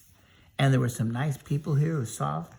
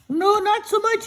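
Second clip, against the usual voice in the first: high, loud cartoon scarecrow; storyteller voicing a high silly scarecrow; 6 dB louder than usual. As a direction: pitch way up and call out as the scarecrow. Scarecrow talks to Dorothy.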